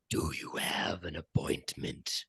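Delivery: low, soft whispered creature voice; menacing monster. Acted. Monster confronts the party, low menacing whisper. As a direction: whisper it low like a slavering beast.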